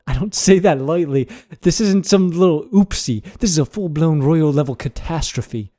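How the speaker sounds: put-on British scolding, punchy opening; British accent. Podcaster reads a mock nightly news script, British accent. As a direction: read it as an indignant British newsreader. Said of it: "read in a British accent".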